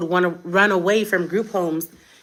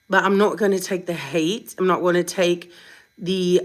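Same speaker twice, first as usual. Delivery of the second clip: ordinary delivery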